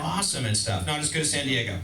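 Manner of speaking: normal talking voice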